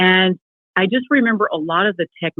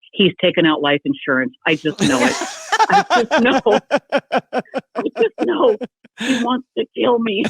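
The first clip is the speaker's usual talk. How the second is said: mock paranoia; 4 semitones higher than usual. Mock paranoid fear of husband.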